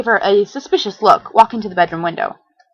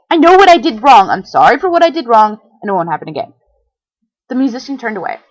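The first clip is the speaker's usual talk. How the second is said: plain reading voice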